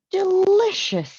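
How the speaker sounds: a big, high, loud "Delicious" after tasting the cake; delighted Mr Rogers. A narrator's reading.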